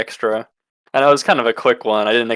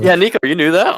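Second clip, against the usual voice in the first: laughing; 7 semitones higher, 4 dB louder than usual. Real, not acted. Teasing disbelief with laugh.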